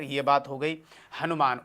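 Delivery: ordinary talk, nothing marked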